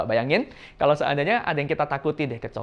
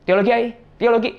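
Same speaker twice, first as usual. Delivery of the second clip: quick higher, louder blurt; hurried, indistinct answer; 8 semitones higher, 5 dB louder than usual. Retold or imitated. Koko dodging Ai's question about his studies, hurried and indistinct. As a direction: rattle it out so it is hard to catch. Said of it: "ngomongnya cepet dan nggak jelas".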